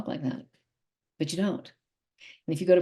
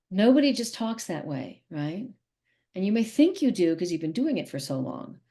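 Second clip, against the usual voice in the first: usual speaking voice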